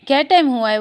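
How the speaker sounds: plain reading voice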